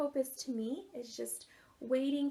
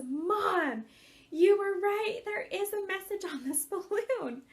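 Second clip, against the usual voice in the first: high, loud thrilled cry; excited son; 8 semitones higher, 6 dB louder, slower than usual. Retold or imitated. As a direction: burst out with the discovery. Son finds message, excited.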